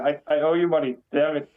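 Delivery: ordinary talk, nothing marked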